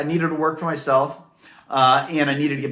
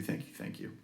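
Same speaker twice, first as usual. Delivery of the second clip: whispered, much softer thank you; 18 dB quieter than usual. Real, not acted. Repeated thanks to members.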